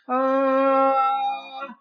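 smothered ah through the nose; nasal ah. Shown as a demonstration. Speaking coach showing the nose voice, smothered ah. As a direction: push the ah up into the nose. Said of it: "The sound is suffocated through the nose".

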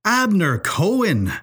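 high, drawn-out exclamation; astonished outburst. A narrator's reading. Professor hears whom she took along, bursting out in disbelief. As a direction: burst out with the name, pitch swooping high. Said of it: "burst O'Malley".

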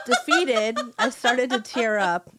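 can't keep a straight face; laughing through words. Real, not acted. Reader laughs through her own story.

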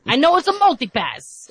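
mock exasperation. To her kids repeating themselves, exasperated high whine. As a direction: cut the kids off, fed up. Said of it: "whenever my kids are repeating something".

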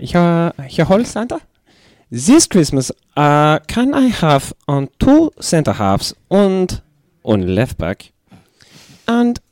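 put-on lightly butchered German accent as a football manager; a hammed-up German accent reading a letter to Santa. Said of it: "I will absolutely butcher the accent".

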